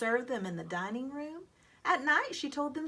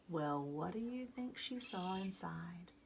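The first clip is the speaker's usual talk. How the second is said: secretive whisper; 3 semitones lower, 7 dB quieter than usual. A narrator's reading. Narrator teasing listening children, whispering. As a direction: lean in and whisper the question.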